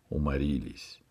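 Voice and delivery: ordinary delivery, nothing marked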